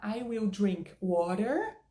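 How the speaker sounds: slow American accent, WATER with a strong R; American WATER, pulling the final R. Shown as a demonstration. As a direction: demonstrate American English, tapped T in WATER. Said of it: "com essa pronúncia".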